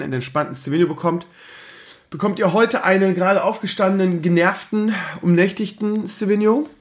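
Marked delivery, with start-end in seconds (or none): none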